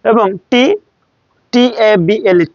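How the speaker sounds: ordinary talk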